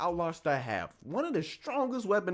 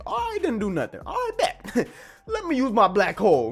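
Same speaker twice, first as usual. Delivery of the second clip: cocky hero voice; 4 semitones higher, 6 dB louder than usual. Retold or imitated. Voicing Gene in fight scene.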